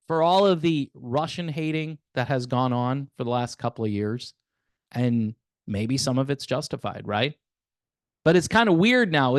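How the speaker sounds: usual speaking voice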